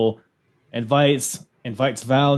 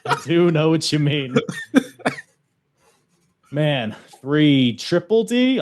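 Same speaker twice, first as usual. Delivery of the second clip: usual speaking voice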